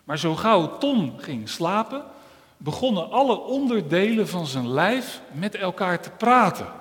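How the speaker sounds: ordinary talk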